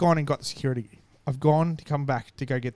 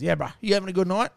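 higher-pitched cocky imitation; imitated arrogant bloke; 5 semitones higher than usual. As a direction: needle him with a mock-friendly question. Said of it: "that just cocky, arrogant".

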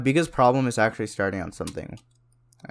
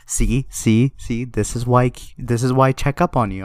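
lightly voiced mother proving a point; insistent, slightly louder mock scolding; mom's I-told-you-so; 4 dB louder than usual. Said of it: "And she's like".

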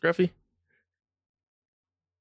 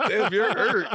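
laughing; 6 semitones higher, slower than usual. Retold or imitated. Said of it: "I'm like".

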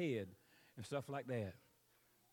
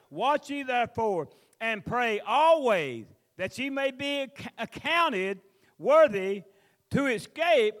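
loud, slow fiery preaching; preaching scripture; 4 semitones higher, 7 dB louder, slower than usual. Acted. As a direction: call it out slowly, full voice. Preacher reads scripture at full force.

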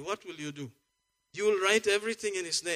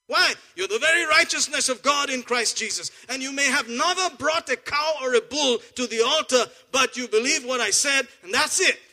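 full-force preaching; 5 semitones higher, 6 dB louder than usual. Acted. Preacher to his congregation, about righteousness, in loud full-force preaching. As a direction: preach it out loud and high, building fire.

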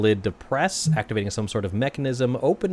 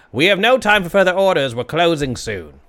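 loud barkeep voice, pitched up; gruff closing call; 7 semitones higher, 5 dB louder than usual. Acted. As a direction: bark it out like a tired barkeep.

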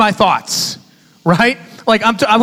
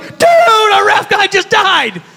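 panicked shout; 11 semitones higher, much slower than usual. Retold or imitated. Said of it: "We're like".

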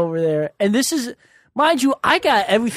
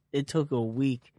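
ordinary talk

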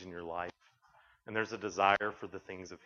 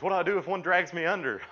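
loud high frightened question; panicked question; 7 semitones higher, 7 dB louder than usual. Retold or imitated. Brother-in-law scared of dolphins asks the guide, loud and panicky. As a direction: ask it like you're terrified of sharks.

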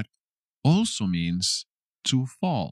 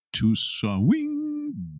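much higher, elongated take of swing; playfully drawn-out; 12 semitones higher, 3 dB quieter than usual. Shown as a demonstration. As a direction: SWING held long on a high swooping note. Teacher playfully stretches the word.

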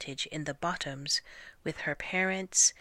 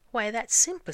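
higher, louder, pleased with herself; proud; 7 semitones higher, 7 dB louder than usual. A narrator's reading. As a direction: answer proudly, pitched up and loud.

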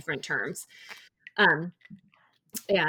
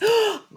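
excited gasp; 8 dB louder than usual. Real, not acted. Excited recognition of pickle elevator.

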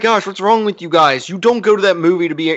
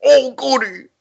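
put-on high, whiny man-child voice; fedora neckbeard; 9 semitones higher, slower than usual. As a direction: whine like a basement-dwelling nerd. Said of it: "neckbeard voice".

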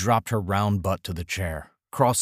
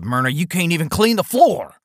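put-on higher, louder cranky old man; berating husband; 8 semitones higher, 6 dB louder than usual. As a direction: yell the insult at the wife.

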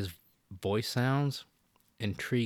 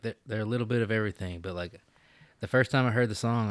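ordinary talk

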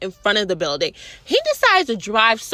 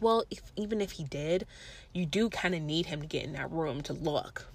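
softer, gentle advice from a friend; softly voiced friend; 6 semitones lower, 14 dB quieter than usual. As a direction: talk her down softly like a calm friend. Friend's calm advice voiced in story.

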